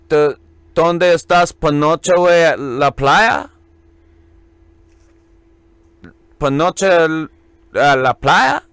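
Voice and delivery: awkward gringo Spanish; bad Spanish. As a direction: butcher the Spanish like a tourist. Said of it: "horrible Spanish".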